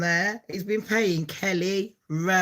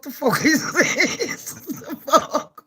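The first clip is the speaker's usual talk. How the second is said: baffled disbelief; 7 semitones higher, much slower than usual. Retold or imitated. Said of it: "I thought".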